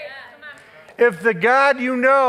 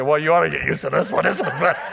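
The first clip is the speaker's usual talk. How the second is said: fast, amused, chuckling; laughing tease; 4 semitones lower, faster than usual. Retold or imitated. Preacher teasing his mother-in-law about her changed husband, laughing. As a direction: rib her with a grin. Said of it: "I said".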